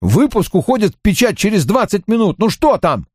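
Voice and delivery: high, urgent and desperate. A narrator's reading. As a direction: press for news in despair. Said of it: "Его в голосе звучало отчаяние".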